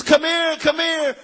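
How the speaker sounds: high, loud calling, repeated as an echo; echoing shout. Retold or imitated. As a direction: call someone over from far off. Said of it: "and it echoes".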